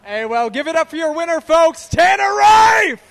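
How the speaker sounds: building to a shouted, stretched-out name; big, high trackside announcer shout; announcer's winner introduction. Real, not acted. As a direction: rouse the crowd for the winner. Announcer presents winner to crowd.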